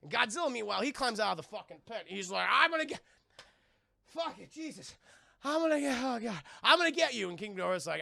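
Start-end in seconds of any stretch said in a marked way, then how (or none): 2.5-7.3 high, loud vengeful yell; hammed-up monster rage; furious threat. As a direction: swear revenge. Voicing Godzilla's threat.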